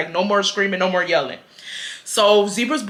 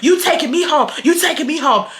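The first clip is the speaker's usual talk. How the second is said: put-on bossy boyfriend; 7 semitones higher than usual. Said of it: "He told myself".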